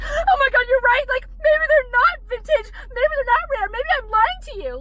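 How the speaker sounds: high-pitched, whiny sarcastic wail; mock agreement. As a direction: play hammed-up horror at being found out. Mock-agreeing with people doubting her items.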